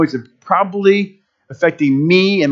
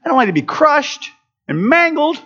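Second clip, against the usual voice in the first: very high-pitched naive squeal, easing down slightly; eager soccer guy; 13 semitones higher than usual. Retold or imitated. Soccer guy answering the football coach, high and naive. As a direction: imitate a naive player in a squeaky voice. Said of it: "soccer guys are like".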